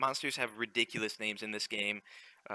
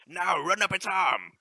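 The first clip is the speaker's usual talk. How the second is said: ordinary delivery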